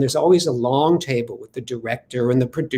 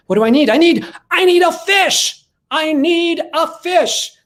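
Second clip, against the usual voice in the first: sudden eureka; 12 semitones higher, 4 dB louder than usual. Retold or imitated. In the car he suddenly realizes he needs a fish, much higher and louder. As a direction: shout it out like a divine message just hit.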